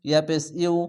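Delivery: ordinary talk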